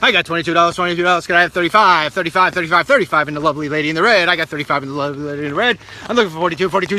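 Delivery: fast auctioneer chant. Shown as a demonstration. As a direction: rattle off bids at speed. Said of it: "I taught myself how to speak fast".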